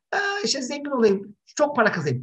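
high, breezy daydreaming voice; mock a naive wish for money; naive dreamy wish.

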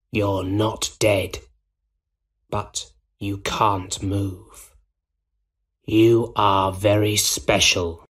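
slow, sinister, hushed villain voice; quiet Goblin. A narrator's reading. Goblin talks to helpless Spider-Man, quietly sinister. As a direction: speak slowly and quietly, coldly persuading. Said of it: "the Green Goblin said quietly".

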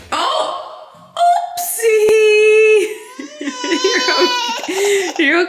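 high, laughing reassurance; laughing oopsie. Real, not acted. Laughing as child falls.

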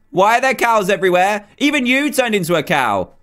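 normal talking voice

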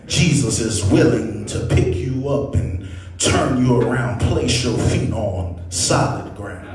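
normal talking voice

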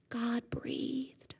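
breathy hushed whisper. Acted. Preacher telling how God breathed, whispered softly. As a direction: let the words fall to a whisper.

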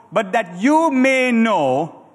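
slow, deliberate reading aloud with the church; slow scripture reading. Shown as a demonstration. Preacher reads slowly for the congregation to repeat. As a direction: stretch each word so the crowd can follow. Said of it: "come on, say it with me".

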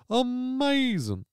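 amazing sung out high, slow and falling; silly drawn-out amazing. Pretend. Host mocking his own word in a goofy voice.

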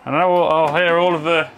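normal talking voice

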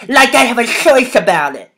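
exasperated yell; shouted. A narrator's reading. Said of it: "he shouted".

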